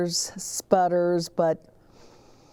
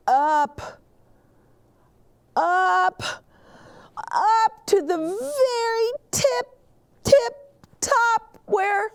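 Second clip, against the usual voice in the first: building higher and louder with each up; rising excitement; 3 dB louder than usual. A narrator's reading. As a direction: strain upward, almost crying out at the top. Climbing to the very top.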